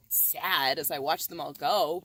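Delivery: plain reading voice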